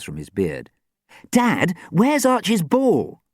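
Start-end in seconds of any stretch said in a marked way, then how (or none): 1.3-3.2 slow, high, loud demand; insistent raised-voice question. A narrator's reading. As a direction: demand an answer urgently. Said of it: "Sam demanded".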